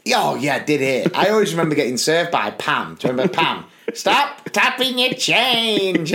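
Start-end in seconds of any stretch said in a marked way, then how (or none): 3.9-6.1 slow, higher-pitched mock scolding; imitated canteen scold; dinner lady's telling-off. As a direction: draw out each word as a nagging dinner lady. Imitating Pam the dinner lady.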